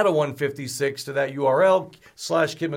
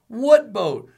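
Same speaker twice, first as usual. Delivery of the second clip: asked aloud; 6 semitones higher, 8 dB louder than usual. A narrator's reading. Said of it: "Novi asked aloud, half asleep".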